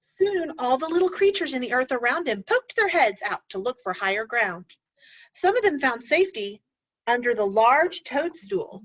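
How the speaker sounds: ordinary delivery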